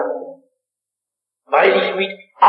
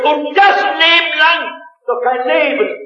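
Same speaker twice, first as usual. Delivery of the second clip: a touch of despair, higher and slow; desperate; 8 semitones higher, 3 dB louder than usual. Retold or imitated. Young locksmith despairing about his endless workweek, higher and slow. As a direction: plead it, worn out and desperate. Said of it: "sagt er ganz verzweifelt".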